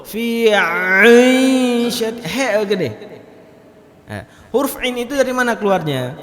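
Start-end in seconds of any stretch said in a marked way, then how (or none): 0.0-2.9 Fi aishat with a thick, bent ain, mock wrong; teacher mocking the thick ain; wrong heavy ain. Shown as a demonstration. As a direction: overdo the ain, heavy and twisted. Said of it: "baca huruf ain yang meklau".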